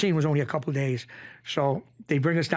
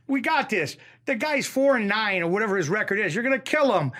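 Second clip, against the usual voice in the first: raised-pitch, rallying cornerman; acted pep talk; 5 semitones higher, 3 dB louder than usual. Chicky to the boxer before his fight, acted pep talk. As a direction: hype up the fighter with conviction.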